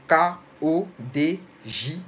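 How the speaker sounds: slow letter-by-letter dictation; very slow, deliberate spelling. Shown as a demonstration. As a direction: space out each letter for someone typing. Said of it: "tu mets pas d'espace au milieu".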